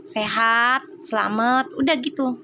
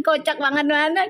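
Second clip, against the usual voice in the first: usual speaking voice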